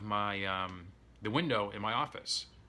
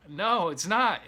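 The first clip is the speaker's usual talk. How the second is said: high, loud cry of protest; a boy shouting no at the crocodile; shouted denial; 10 semitones higher, 8 dB louder, slower than usual. Retold or imitated. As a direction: yell it back in disbelief. Boy denies crocodile's claim.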